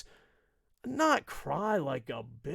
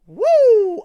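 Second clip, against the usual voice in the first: loud, much higher whoop; cheer; 16 dB louder than usual. Real, not acted. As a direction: let out a big cheering woo. Sudden cheer.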